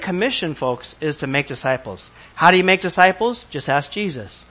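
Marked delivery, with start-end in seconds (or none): none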